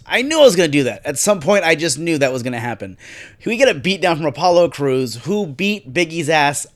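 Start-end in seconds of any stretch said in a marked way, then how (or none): none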